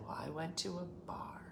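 hushed flat punchline under the breath; deadpan comeback. Retold or imitated. Mother answering the church brag with a quiet deadpan. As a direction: throw it away quietly.

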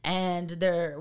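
normal talking voice

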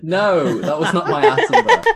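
high, loud, laughing denial; laughing shout. Real, not acted. Laughing protest at being baited.

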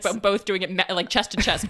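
usual speaking voice

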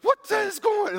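high, loud shocked cry; shocked outburst. Acted. Simon shocked at the woman at his table, high loud outburst. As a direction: blurt it out high in disbelief.

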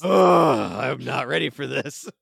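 loud groan, then pained complaint; mock-pained groan of a hero flat out; winded groan. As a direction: groan it out, playing worn out. Acting Arnold lying hurt on ground.

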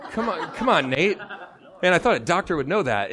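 ordinary talk

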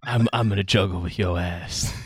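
low, soft Seagal threat; quiet, menacing Seagal line; Steven Seagal voice. Retold or imitated. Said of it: "I've been watching a lot of Seagal movies".

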